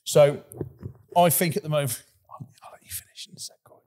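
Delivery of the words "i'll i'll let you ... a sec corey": whisper. Real, not acted. Quiet aside near the mic.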